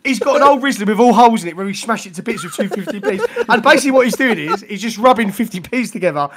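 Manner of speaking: loud, high, laughing narration; real laughter retelling the fifty P story; laughing through it. Real, not acted. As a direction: keep breaking into laughter as you tell it.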